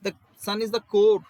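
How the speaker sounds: core stretched out slow and loud; "The sun is the core" spelled out slowly, with "core" stressed; slow emphatic answer. Shown as a demonstration. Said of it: "What is sun?". (धीमे, ज़ोर देकर "core" पर)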